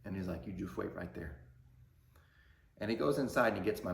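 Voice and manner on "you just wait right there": soft, cold and held-back threat; stoic anger. Retold or imitated. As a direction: keep the anger quiet and contained. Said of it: "stoic anger, like, a different anger".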